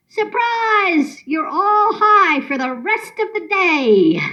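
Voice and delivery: high, drawn-out imagined reveal; yelled surprise. Acted. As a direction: call it out high, stretching the first word. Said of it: "I'll yell".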